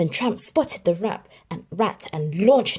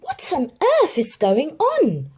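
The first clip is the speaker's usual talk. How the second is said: higher, louder startled question; surprised; 9 semitones higher, 6 dB louder than usual. A narrator's reading. Jim finds dog wagon leaving, surprised. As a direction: ask in alarm. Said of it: "asked Jim".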